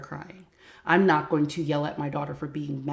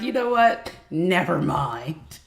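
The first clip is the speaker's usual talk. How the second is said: imitating son; 4 dB louder, slower than usual. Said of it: "he was like".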